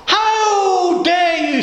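high, drawn-out indignant exclamation in a posh voice; outraged posh lady. Retold or imitated. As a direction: mimic an appalled posh lady, stretching each word. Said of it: "Very posh lady".

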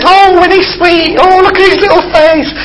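high cooing voice imitating delighted old ladies. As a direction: coo over the baby photo like a sweet old lady. You could hear people going.

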